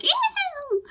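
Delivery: very high, thin squeal; excited squeak. Shown as a demonstration. Showing viewers how excited she gets, squeaky squeal. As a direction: let out a mock excited squeak. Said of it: "why am I always so squeaky? When I get really excited".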